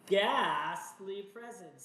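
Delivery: loud, drawn-out card title; dramatic announcement. Acted. Player reveals the drawn card, dramatic announcement. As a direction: announce it big, stretching the words.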